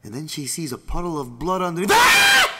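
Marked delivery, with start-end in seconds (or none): 1.9-2.5 very high, loud shriek; horrified scream. Acted. Wife sees a puddle of blood, screaming. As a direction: scream out in horror, pitch soaring.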